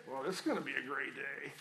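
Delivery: low, soft and grudging; glum sarcastic. Shown as a demonstration. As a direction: drag it out like you dread the day. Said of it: "let me say this same, same sentence two different ways".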